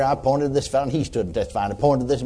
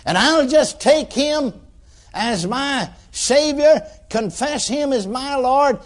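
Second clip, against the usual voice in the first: high, slow, deliberate imitated declaration; emphatic confession; 10 semitones higher, slower than usual. New convert confessing before the men, slow high declaration. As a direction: declare it phrase by phrase, raised and drawn out.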